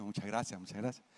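nervous thanks. Retold or imitated. Nervous pastor thanking the monsignor, soft timid murmur. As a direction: mumble your thanks, nervous visitor.

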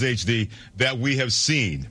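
ordinary delivery

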